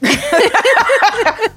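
high, loud cackle; gloating cackle. Acted. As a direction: cackle over your money. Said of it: "That's my rich person counting my money sound".

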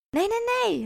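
high, loud repeated denial; frantic crying out. Acted. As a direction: protest loudly, refusing to hear it. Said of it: "roep ik". (hoog en luid protesterend)

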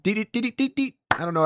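normal talking voice

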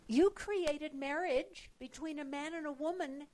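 loud, exasperated appeal to God; imagined prayer. Pretend. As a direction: plead loudly with God over the country. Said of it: "got on our knees and we said".

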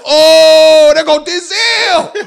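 high, loud mock-awed holler; starstruck shout. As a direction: holler it as if spotting your idol. Awestruck at first seeing Denzel.

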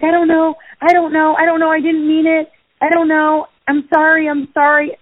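high, pleading imitation of a flustered child; upset, scared kid. Scared kid apologising to his friend's mother, imitated distress. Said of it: "he's crying".

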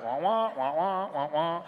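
muffled wordless wah-wah voice; Charlie Brown's teacher. Acted. Preacher mimicking the unintelligible restaurant drive-through speaker, wah-wah teacher voice. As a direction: imitate garbled grown-up talk through a speaker. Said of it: "where Charlie's Brown's teacher".

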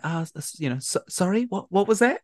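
high, stammered puzzled question; Cho Chang. Retold or imitated. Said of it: "she doesn't quite hear it".

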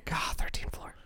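hushed groaning whisper. Real, not acted. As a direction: breathe it out in dismay. Groaning memory of bad movie.

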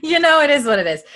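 high, loud, laughing delivery; real laughter. Real, not acted. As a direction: laugh through a cheerful shrug. Laughing after co-host's teasing.

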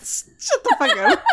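high laughing retort. Real, not acted. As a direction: squeal it through laughter.

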